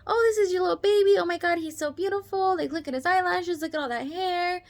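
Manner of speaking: very high, bright and loud chipper nurse greeting; super high-pitched voice. Retold or imitated. NICU nurse greeting the new parents, super high-pitched chipper voice. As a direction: squeal it like a bubbly nurse at 2 a.m. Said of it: "she had, like, a super high-pitched voice".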